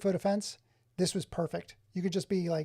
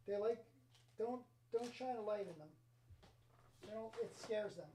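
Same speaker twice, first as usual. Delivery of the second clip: much softer, hushed as if the controllers might hear; 3 semitones higher, 14 dB quieter than usual. Pretend. Streamer hushing over his controllers so they aren't scared. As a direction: hush it down like not to scare them. Talking about shy controllers.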